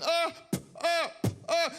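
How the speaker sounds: high, strained uh uh uh; mock hurt boy stammering; pained stammer. Boy shows his injury to his mother, mock pained stammer.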